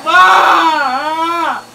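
shouting for mom from another room; calling out. Real, not acted. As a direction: yell for Ma to come help. Calling for his mother for help.

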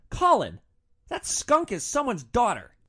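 loud, high-pitched rebuke; mock scolding. One passenger rebukes another over a crude insult, indignant. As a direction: scold a friend in outrage.

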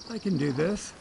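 panting while talking; winded from the climb, breathing hard. Real, not acted. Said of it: "You can tell by my panting that I'm winded".

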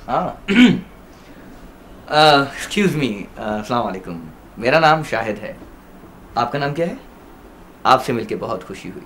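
usual speaking voice

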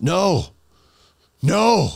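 high, frantic protest; panicked demon. Acted. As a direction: cry out to make it stop, rising as you go. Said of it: "then the demon goes".

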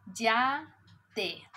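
ordinary talk

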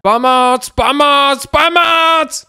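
repeated high cry for help; shouting from below. Pretend. Fallen player calls friends from underneath, shouting for help. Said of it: "proč [name] křičí ze spodu".

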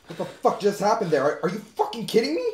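high-pitched angry outburst; outraged disbelief. Acted. Man reacting to what just happened, outraged. David confronts Carol after shock.

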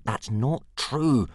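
slow, loud denial; protesting. Acted. Hiccup to Old Wrinkly, slow embarrassed protest. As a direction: flush and deny it. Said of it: "protested Hiccup".

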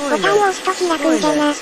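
put-on creaky wise old man. Old-man speech ending じゃな.

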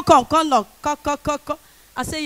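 ordinary delivery

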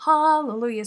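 a loud, joyful hallelujah at being done. Real, not acted. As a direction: shout hallelujah in relief. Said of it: "Sorry, that's loud".

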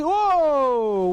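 usual speaking voice

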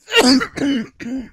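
loud staged coughing fit; fake cough. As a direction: pretend to cough uncontrollably. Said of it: "break out into a coughing fit".

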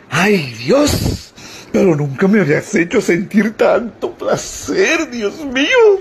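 hammed-up ecstatic gasping, rising at the end; mock rapture; wife swooning with pleasure. Wife overcome with pleasure in joke.

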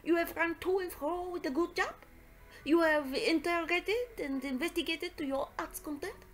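put-on delighted, lilting French-accented detective; Poirot greeting affectionately. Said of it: "He greeted me with much affection".

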